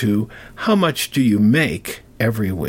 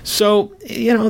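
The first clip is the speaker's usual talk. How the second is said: ordinary delivery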